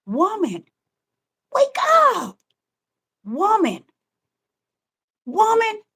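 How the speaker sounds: lightly voiced inner spirit calling her by name, rising on wake up; a hint of a spirit pleading with a tired woman. Preacher voicing the woman's spirit, speaking to her flesh, lightly voiced and pleading. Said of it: "This is what her spirit is saying".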